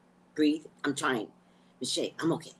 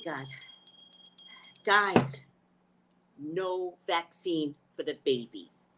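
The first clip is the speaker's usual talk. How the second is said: ordinary talk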